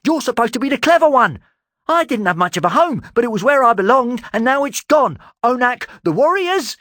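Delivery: high, loud angry tirade; furious rant. Acted. Talon raging at Erasmus over his lost home. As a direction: let it pour out in rage.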